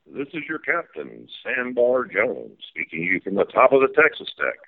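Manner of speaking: folksy tour-guide captain calling the sights; riverboat captain spiel. Pretend. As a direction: play the steamboat captain giving the tour.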